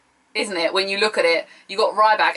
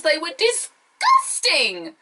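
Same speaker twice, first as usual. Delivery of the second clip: drawn-out disgust; 8 semitones higher, much slower than usual. Real, not acted. Mocking Summer Rae's earrings.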